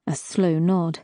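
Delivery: plain reading voice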